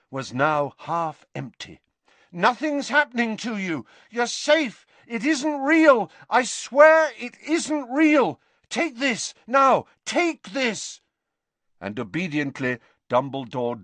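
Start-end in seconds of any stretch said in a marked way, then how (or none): 2.3-11.0 high, raised, insistent reassurance; emphatic, frantic comforting, slowing on key phrases; urgent pleading. A narrator's reading. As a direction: insist desperately that he is safe. Harry urgently calms screaming Dumbledore.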